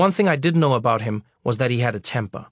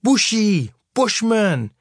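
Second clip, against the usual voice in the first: higher, mocking shouted names; jeering name-calling; 7 semitones higher, much slower than usual. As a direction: taunt him from the pack. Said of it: "calling me names".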